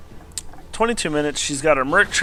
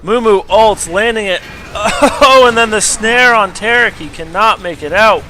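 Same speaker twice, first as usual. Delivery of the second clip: higher, louder caster call of the fight; excited play-by-play; 8 semitones higher, 8 dB louder than usual. Acted. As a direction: call the action like a live caster. Live play-by-play of replay.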